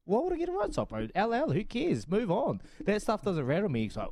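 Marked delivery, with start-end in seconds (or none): 0.1-2.6 high-pitched incredulous brush-off; mock exasperated comeback; dismissive retort. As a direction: wave off a fussy mate, pitched up. Acting out dismissive retort to teammate.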